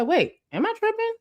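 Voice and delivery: ordinary talk, nothing marked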